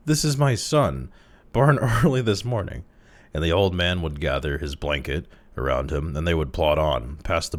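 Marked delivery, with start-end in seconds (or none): 0.1-2.8 high, loud laughing introduction; laughing embarrassment. Pretend. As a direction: laugh through the awkward line. Imagined awkward introduction.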